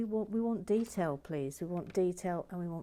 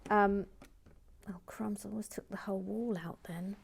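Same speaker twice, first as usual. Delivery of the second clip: ordinary delivery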